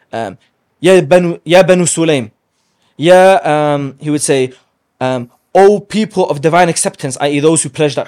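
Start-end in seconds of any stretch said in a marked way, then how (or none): 0.8-3.4 loud, high summons across a battlefield; calling out to clans. Retold or imitated. Prophet calling each clan by name, loud high summons. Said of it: "he would call out to each clan". 5.5-7.2 raised, high summons; calling out. Retold or imitated. Prophet hailing the Hudaybiyah pledgers, loud raised summons. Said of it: "he would call out to each clan".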